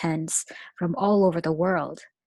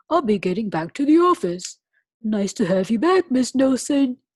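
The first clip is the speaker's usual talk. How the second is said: louder fussy principal; 3 semitones higher, 8 dB louder than usual. Acted. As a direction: play the prim principal. Voices the principal.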